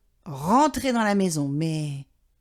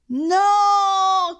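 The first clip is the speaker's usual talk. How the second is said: loud, much higher cry of no; Papa shouts; 10 semitones higher, 6 dB louder than usual. A narrator's reading. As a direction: yell it out in despair. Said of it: "crie papa".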